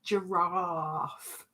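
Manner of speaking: giraffe as zhuh-RAHF, drawn out; giraffe, zhuh-RAHF, long; her own giraffe. Shown as a demonstration. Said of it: "I say giraffe".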